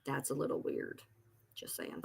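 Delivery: whispered aside. Real, not acted. Sly whispered aside to her viewers. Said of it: "whispered aside".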